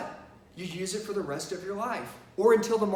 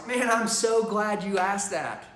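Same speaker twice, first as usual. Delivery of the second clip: slow, pleased and confident; glad reply; slower than usual. Shown as a demonstration. Salesman gladly answering the customer's price question. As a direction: welcome the objection gladly. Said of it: "I want you to reply like this".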